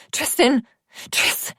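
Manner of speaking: loud, high pleading calls; panicked plea to a wounded friend; frantic calling. A narrator's reading. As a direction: try to rouse him, frantic. Friend dying, she calls.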